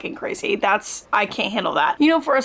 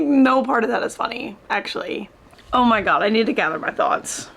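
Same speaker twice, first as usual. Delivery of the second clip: ordinary talk, nothing marked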